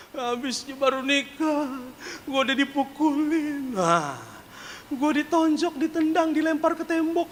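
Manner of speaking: high, slow plaintive complaining woman; whining wife. Retold or imitated. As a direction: whimper it out like the beaten bride. (istri mengeluh merengek dengan suara tinggi)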